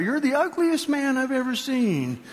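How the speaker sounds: high parrot voice; high-pitched parrot repeating its insult; taunting parrot. Acted. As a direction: pipe it up like a mimicking parrot. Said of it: "there goes the parrot".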